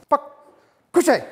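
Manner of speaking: loud, high command; firm dog command. Real, not acted. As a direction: bark the order at the dog. Ordering dog down.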